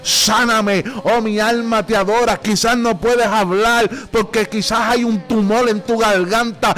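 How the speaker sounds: relentless, full-voiced exhortation; fervent preaching. Real, not acted. Preacher exhorting sick listeners to call on God, fervent preaching. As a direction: urge the sick to cry out, driving on without letup.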